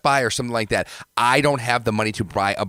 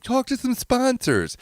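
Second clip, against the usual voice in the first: mocking high voice; slower than usual. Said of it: "everyone's like".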